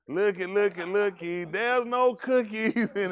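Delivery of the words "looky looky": usual speaking voice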